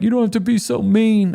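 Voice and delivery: mock crying.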